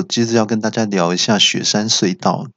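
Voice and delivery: ordinary talk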